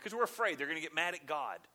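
ordinary delivery

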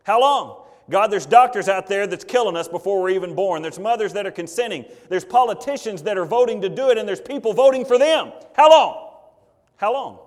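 from a plea up to a full shout; building cry. Retold or imitated. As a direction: unborn babies crying to God, building shout. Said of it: "continually cry out to God and say".

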